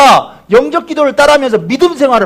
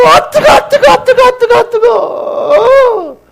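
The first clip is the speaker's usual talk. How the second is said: crying out in burning pain; 11 semitones higher, much slower than usual. Retold or imitated. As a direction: scream as if burning in hellfire. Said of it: "몸을 막 부들부들 떨면서".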